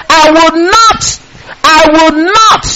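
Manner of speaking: "not" held high and drawn out; slow, high, weighty stress on "not"; emphatic NOT. Retold or imitated. Preacher counts God's promise out for listeners, hammering "not".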